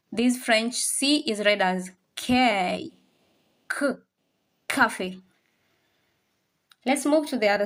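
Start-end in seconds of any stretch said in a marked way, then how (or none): none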